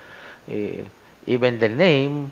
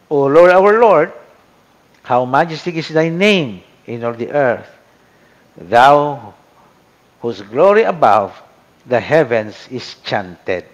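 solemn scripture reading; 6 dB louder than usual. Shown as a demonstration. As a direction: proclaim the psalm to the class.

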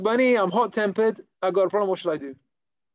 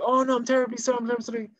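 higher-pitched, mildly hammed-up apology; profuse apology; 6 semitones higher, faster than usual. Shamash apologizing to the man he soaked, profusely. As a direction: play at being terribly sorry.

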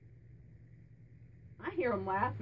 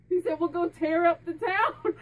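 laughing quote; 5 semitones higher, 8 dB louder than usual. Real, not acted. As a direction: gleefully pass on his cheeky line. Still laughing, relaying spirit.

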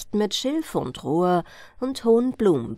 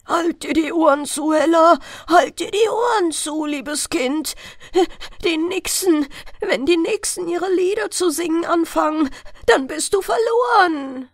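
much higher and louder, urgent warning; frightened shouting; 11 semitones higher, 6 dB louder than usual. A narrator's reading. Father warning daughter Hella against the nixies, frightened shout. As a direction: yell at her to save her from the nixies. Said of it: "rief er plötzlich ganz bleich vor Schrecken".

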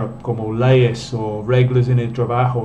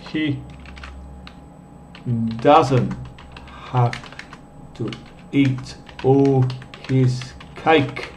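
dictating word by word; 5 dB quieter, slower than usual. Shown as a demonstration.

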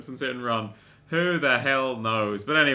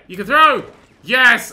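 high, very loud delight; excited shout; 8 semitones higher, 13 dB louder, slower than usual. Real, not acted. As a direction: burst out in triumph. Delighted at finding throw move.

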